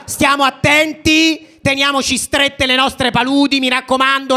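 high, loud rabble-rousing speech; mock political rally speech; rally cry. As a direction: rouse the crowd like a protest leader. Comic rally speech to audience.

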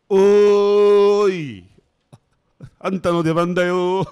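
loud, high holler, long drawn-out hail; shouted stage call; calling someone over. Real, not acted. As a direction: summon them from afar at full volume. Calling a friend on to appear.

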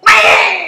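put-on shrill high baby "Wah!" cry; imitated baby wail. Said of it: "She's going".

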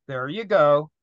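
ordinary delivery

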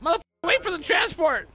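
high, furious yell; angry yell. Real, not acted. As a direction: yell it in frustration. Yelling at a player mid-fight.

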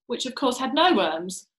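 usual speaking voice

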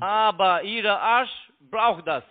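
loud, very slow, insulting taunt; taunting shout. Real, not acted. Scammer yells a mocking insult at the caller.

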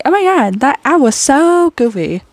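high, jokey mock outrage; overplayed shock at an ad; goofy reaction. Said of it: "just being like".

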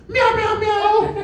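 high, loud mock cat meows; playful high meowing; catcall meows. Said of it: "I just heard".

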